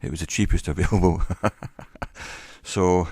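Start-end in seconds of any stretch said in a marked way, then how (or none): 1.3-2.4 soft trailing chuckle; quiet self-amused chuckling. Real, not acted. Amused at own cheap microphone.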